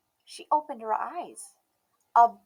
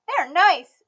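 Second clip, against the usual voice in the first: put-on high, bright little girl voice, loud and proud; a stubborn little girl declaring herself; Emmy's child voice; 8 semitones higher, 10 dB louder than usual. A narrator's reading. Emmy speaking.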